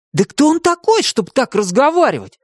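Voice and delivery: indignant. A narrator's reading. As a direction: burst out in outrage. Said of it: "возмутился Тим".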